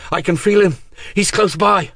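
high, loud, eager voice; urgent excitement; excited. Acted. Miranda excited, sensing him.

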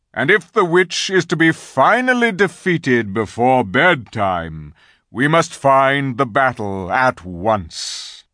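great lion calling out in measured tones; commanding lion leader. A narrator's reading. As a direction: address the whole army as Aslan. Aslan rallies his army.

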